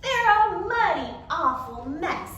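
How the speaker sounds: grumbling at his muddy pants; grumpy complaint. Acted. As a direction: drag it out, put out and cross. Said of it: "which was his bad mood noise".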